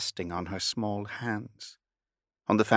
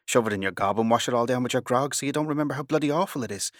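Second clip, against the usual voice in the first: rattled off fast, higher; fast rant; 4 semitones higher, faster than usual. Acted. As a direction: rush it out in one exasperated breath. Captain's rant speeds up.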